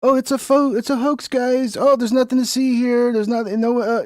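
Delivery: high, dismissive mock denial; sarcastic high-pitched parody; mocking Trump.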